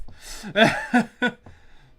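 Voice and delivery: high loud burst of laughter; laughing. Real, not acted. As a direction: laugh it off, embarrassed. Laughs at own breath-holding.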